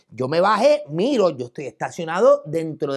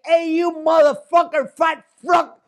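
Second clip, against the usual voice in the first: loud, high, slow mock cursing; re-enacted insults; 8 semitones higher, 5 dB louder, slower than usual. Cursing the driver in front of the officer, re-enacted insults. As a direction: hurl the insult out, stretched and punchy.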